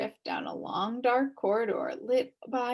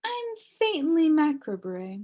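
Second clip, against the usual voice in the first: put-on cheerful old witch; 6 semitones higher, 4 dB louder than usual. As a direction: reassure the frightened boy. Witch introduces herself.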